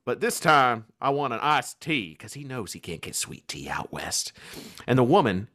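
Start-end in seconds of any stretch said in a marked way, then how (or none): 0.1-2.1 louder, lightly put-on Kentucky drawl; Kentucky accent. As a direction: imitate a friend's Kentucky order at the counter. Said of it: "hearing his accent".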